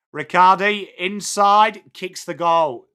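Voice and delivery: punchy, loud commentary easing into the score; live goal call. Real, not acted. Streamer announcing Riccardi's goal to viewers, live commentary. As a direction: punch out the goal, then settle to tally it.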